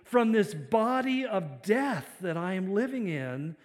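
loud, slow plea for rescue; crying out. Retold or imitated. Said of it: "He cries out and says".

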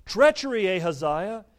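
loud, high cry of alarm; calling out. A narrator's reading. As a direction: call a warning to your ally. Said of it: "calling out to Ahaziah".

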